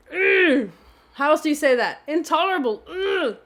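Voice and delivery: Intolerable said high and loud with a strained mm after it; emphatic groan. Shown as a demonstration. Showing how to say Intolerable, high and loud with a groaning mm. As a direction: push Intolerable out, then groan mm. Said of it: "Needs a noise after it to really put emphasis there".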